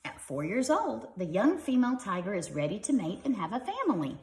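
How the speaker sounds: lively, bright storybook reading; reading to children. Acted. Parent reading a tiger book to kids, lively storybook voice. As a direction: read it like a bedtime picture book.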